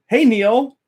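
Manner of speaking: lightly voiced friendly shout-out; wife calling out.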